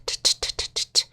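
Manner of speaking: "t" released as a voiceless breathy puff; just air on each "t", no voice; whispered unvoiced t. Shown as a demonstration. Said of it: "So when you say t".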